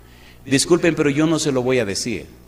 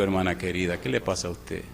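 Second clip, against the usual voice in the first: gentle; 6 semitones lower, 7 dB quieter than usual. Retold or imitated. Calming the angry wife.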